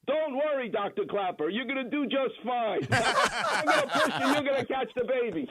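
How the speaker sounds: high, laughing imitation of a calm mother; lightly voiced patient soothing the nervous student; reassuring patient. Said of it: "She leans her head over the drapes and goes".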